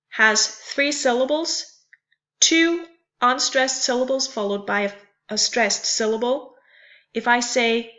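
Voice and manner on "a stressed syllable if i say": ordinary delivery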